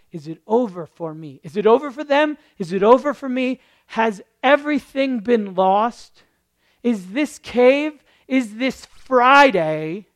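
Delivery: mock despairing plea; anguished questions.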